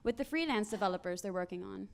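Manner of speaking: ordinary talk, nothing marked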